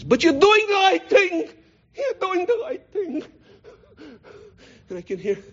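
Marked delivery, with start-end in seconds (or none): 0.0-3.2 very high, slow, strained cry; emotional old aunt. Retold or imitated. Old aunt urging her nephew on, emotional and breathless. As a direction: squeeze it out high and breathless, near tears. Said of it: "She was so emotional".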